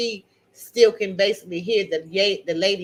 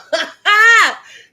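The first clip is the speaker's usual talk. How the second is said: high, loud real cackle; loud burst of laughter; 12 semitones higher, 12 dB louder than usual. Real, not acted. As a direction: burst out laughing at your own story. Laughs at giving away her car.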